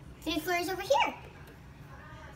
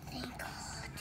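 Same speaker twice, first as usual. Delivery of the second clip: relieved whisper; 5 semitones lower, 14 dB quieter than usual. Real, not acted. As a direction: breathe it out in a whisper. Relief after counting.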